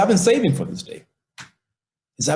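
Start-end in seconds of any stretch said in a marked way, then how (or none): 0.0-1.0 proud father. Retold or imitated. Father reveals his savings.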